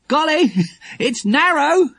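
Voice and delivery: high, slow call over a gap; shouting across. A narrator's reading. Jack to the watchers from the window, shouting across. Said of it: "he shouted across the plank".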